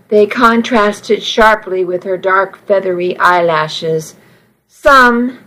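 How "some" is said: plain reading voice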